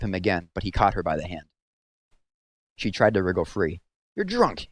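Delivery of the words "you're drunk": a mock indignant yell. A narrator's reading. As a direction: hurl it at him as she struggles. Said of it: "she yelled".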